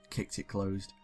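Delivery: normal talking voice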